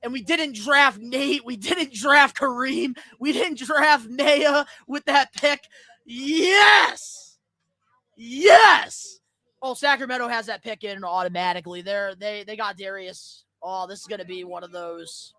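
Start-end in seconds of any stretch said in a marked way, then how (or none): 6.0-9.2 high loud yes; joyful fan yell; excited cheer. Real, not acted. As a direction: cheer with a crack in the voice.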